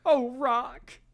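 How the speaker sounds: put-on high-pitched old manager. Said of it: "act it out here".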